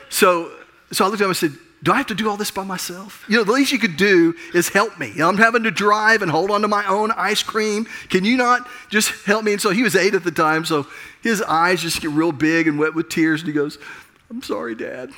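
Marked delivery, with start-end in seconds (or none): 1.8-9.5 put-on raised, higher-pitched frustrated complaint to the boy; a dad snapping at his kid, half mock; exasperated scolding. Scolding son over spilled ice cream. 14.3-15.1 put-on high, soft, small child's apology; lightly voiced teary kid; tearful little boy. Tearful eight-year-old apologizes.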